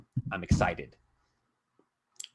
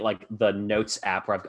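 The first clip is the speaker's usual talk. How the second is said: usual speaking voice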